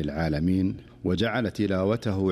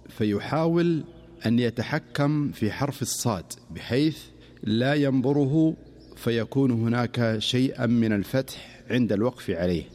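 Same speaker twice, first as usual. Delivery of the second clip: ordinary delivery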